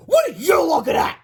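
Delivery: high, loud angry shout; snarling. A narrator's reading. Principal to the woman by the restroom, snarling. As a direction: snap it loudly at a bystander. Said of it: "Mister Krupp snarled".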